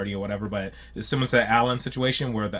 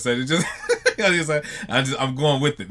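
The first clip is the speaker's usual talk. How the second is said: laughing; 4 semitones higher than usual. Real, not acted. Laughing at his own confusion.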